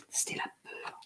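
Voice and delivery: breathy hush on the last words; whispered. A narrator's reading. Reading fear page to children, whispered. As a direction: drop to a whisper to close the page.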